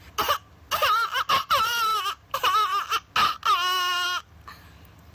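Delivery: put-on high wailing baby cry with laughing edge; imitated infant bawling; baby crying demo. Shown as a demonstration. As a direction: wail like a crying newborn. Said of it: "like, you know, like this".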